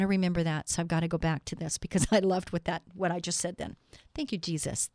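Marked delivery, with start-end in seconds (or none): none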